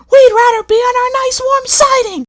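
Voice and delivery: very high, loud whining coach voice; whining coaches. A narrator's reading. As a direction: wail for the warm siding. Coaches wailing complaint.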